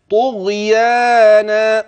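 طغيانا very slow with the alif after ya held; slow chanted طغيانا; long alif. Shown as a demonstration. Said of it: "من ألف بعد الياء".

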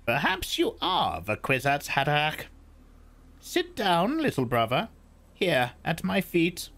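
put-on high, measured old woman's voice; old Reverend Mother. A narrator's reading. Old woman explains the box to Paul, high and measured. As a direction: speak as the stern old crone.

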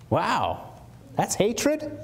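high incredulous question; hammed-up disbelief; mock surprise. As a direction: play the shocked hearer. Mock shocked listener.

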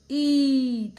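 ई loud and held long; long-vowel demonstration. Shown as a demonstration. Teacher answers her sound question, long loud ई. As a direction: sustain ई loudly. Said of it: "क्या उच्चारण है इसका?".

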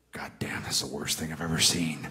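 breathy laughing whisper; whispered disbelief. Retold or imitated. Vince reacting to the awful footage, whispered laughing disbelief. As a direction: whisper it, half laughing in horror. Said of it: "He goes".

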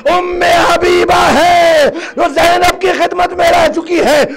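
high, impassioned grieving cry; full-force majlis preaching. Real, not acted. Preacher crying out the tragedy to the mourners. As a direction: preach it out, lamenting.

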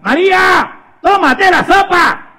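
high, slow, drawn-out command; insistent mother. Acted. Mother ordering María to eat soup, high insistent call. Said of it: "la mamá le decía".

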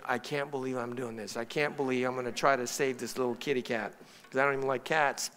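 low, soft self-talk; grumbling to himself. Retold or imitated. Reluctant dad grumbling to himself driving to the pet store, low and soft. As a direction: grumble under your breath in disbelief.

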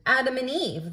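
plain reading voice

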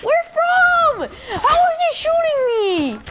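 high, startled questions; can't see who's shooting you; alarmed confusion. Real, not acted. Shot at by an unseen enemy, startled and high.

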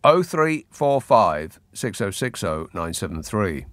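fading station number read; announcer reading the phone number, trailing off. Acted. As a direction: read out the number and let it fade. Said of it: "reads station number, fading".

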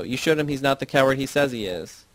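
plain reading voice

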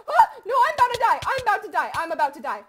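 very loud, high at first, then dropping; panicked yelling. Real, not acted. Player about to die in game, panicked yelling. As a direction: yell it over and over in alarm.

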